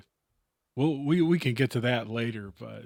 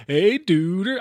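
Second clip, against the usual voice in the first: loud, higher surfer-dude greeting; Pauly Shore; 6 semitones higher, 6 dB louder than usual. Retold or imitated. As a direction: toss it off like a nineties slacker. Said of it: "like, Pauly Shore's fucking".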